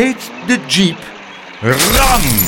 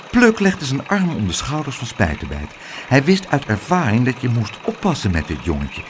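ordinary delivery

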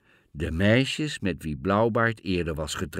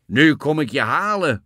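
loud, raised, menacing bellow; thundering shout; 5 semitones higher, 7 dB louder than usual. A narrator's reading. Bluebeard announces he is coming for the girl, bellowing. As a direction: thunder the threat. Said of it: "bulderde Blauwbaard".

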